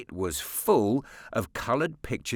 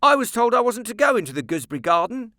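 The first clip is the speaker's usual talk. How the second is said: put-on quick, higher boy's voice; prompt boy; 11 semitones higher, 8 dB louder, faster than usual. A narrator's reading. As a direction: answer promptly and a bit fast as the boy. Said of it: "said Nicholas promptly".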